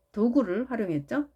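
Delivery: usual speaking voice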